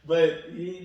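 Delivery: ordinary delivery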